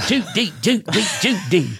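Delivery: lightly sung Cary Grant bit; a hint of old-movie Cary Grant; sing-song mock Cary Grant; hacky Cary Grant. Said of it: "that really hacky Cary Grant impression".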